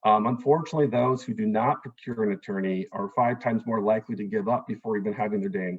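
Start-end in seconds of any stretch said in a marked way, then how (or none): none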